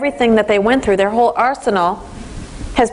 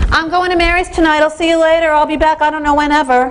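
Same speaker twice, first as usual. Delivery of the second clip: put-on high, breezy teenage announcement; careless teen; 6 semitones higher than usual. Shown as a demonstration. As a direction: toss it off like a teen who doesn't ask.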